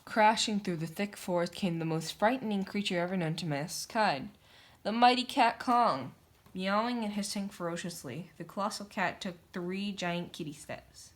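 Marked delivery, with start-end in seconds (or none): 4.8-6.1 dramatic announcement. A narrator's reading. Announcing the monster.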